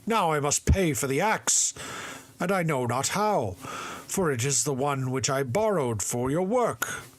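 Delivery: alarmed father fretting over the borrowed axe; frightened father. A narrator's reading. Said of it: "The father was frightened".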